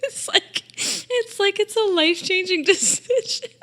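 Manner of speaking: squealed high through real laughter; helpless laughter. Real, not acted. Storyteller mocking her own indecision, helpless laughter. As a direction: squeak the words out while cracking up.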